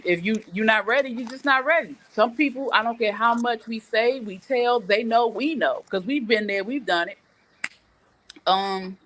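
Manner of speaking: ordinary delivery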